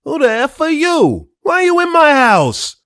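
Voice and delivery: furious high shouting at an intruder; angry yell. Retold or imitated. Dad yelling at the stranger in his house, furious shout. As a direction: scream it like an outraged father. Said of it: "getting yelled at by discount Tony Soprano".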